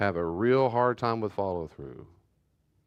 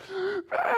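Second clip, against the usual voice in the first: put-on child gasp. Son crying in rearview mirror.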